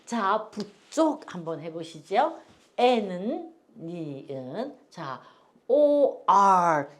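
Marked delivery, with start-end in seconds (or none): 5.7-6.9 오알 spelled out very slowly, each letter stretched; drawn-out letter name. Shown as a demonstration. Teacher spells out OR slowly for the seniors. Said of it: "이게 오알".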